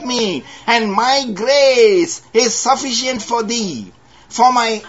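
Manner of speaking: high, ringing preacher's voice; fervent pulpit delivery; preaching. Acted. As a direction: lift it up to the congregation. Preaching the verse.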